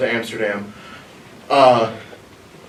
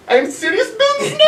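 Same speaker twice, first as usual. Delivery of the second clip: high, laughing mock of the guard; mom's mock accent. Mom quoting the guard back to her son, high and giggling. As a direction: squeak it out between laughs. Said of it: "And she goes".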